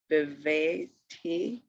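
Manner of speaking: "Beveti" as one slow word; syllables joined. Shown as a demonstration. Teacher putting the syllables together, "Beveti" slowly. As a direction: join "Beveti" up, still slow and measured. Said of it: "That's the last one".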